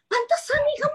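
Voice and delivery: teasing colleague. Retold or imitated. Quoting colleague teasing her.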